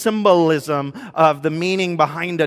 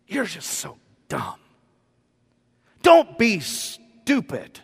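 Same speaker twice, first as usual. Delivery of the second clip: mock scolding parent; 7 semitones higher than usual. Parent's harsh words to child.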